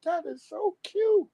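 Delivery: put-on high, slow girlish coo; cooing ladies. Ladies admire the scooter, slow high cooing. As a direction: gush like a charmed girl.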